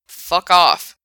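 loud sharp snap; mean it this time; genuine fuck off. A narrator's reading. Frank telling Bob off for real, angry. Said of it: "genuinely that time".

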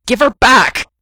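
loud, high furious shout; spat out. A narrator's reading. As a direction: yell the demand with full force. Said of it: "he spat at Doctor Toro".

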